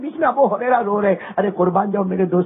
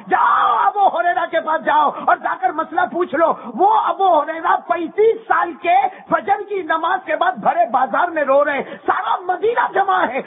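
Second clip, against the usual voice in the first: preaching at full force; 7 semitones higher than usual. Acted. Preacher tells the crowd of Abu Huraira weeping, full force.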